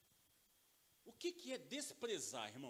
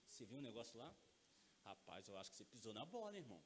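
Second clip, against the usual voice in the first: bem baixinho e grave, quase em segredo; conselho discreto de pai; 12 semitones lower, 15 dB quieter, faster than usual. Acted. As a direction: repreenda de leve, em voz muito baixa. Pai aconselha filho com jeitinho. (toque de pai, de mansinho)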